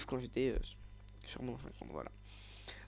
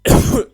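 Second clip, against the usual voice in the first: coughing; 16 dB louder than usual. Real, not acted. Sick vlogger coughing.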